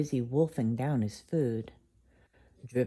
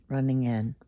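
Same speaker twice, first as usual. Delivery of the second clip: usual speaking voice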